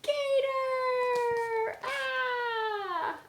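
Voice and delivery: high, loud panicked shriek; frog scream. Acted. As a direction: scream in terror at the gator. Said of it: "and the frog screams".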